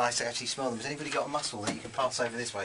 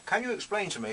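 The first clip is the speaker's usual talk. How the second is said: talking with a mouth full of mussels; 7 semitones higher than usual. Real, not acted. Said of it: "excuse me talking with my mouth full".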